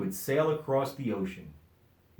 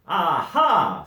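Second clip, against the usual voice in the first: loud, higher discovery cry; triumphant aha; 6 semitones higher, 10 dB louder than usual. A narrator's reading. Dodsworth spotting the duck, loud triumphant aha. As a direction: catch him out. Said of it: "said Dodsworth".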